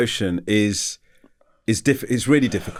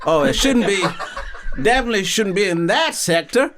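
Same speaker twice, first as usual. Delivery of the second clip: high, improvised American audition voice; American accent; 9 semitones higher than usual. Acted. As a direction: freestyle sci-fi dialogue in hammed-up American. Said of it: "Why don't you try American?".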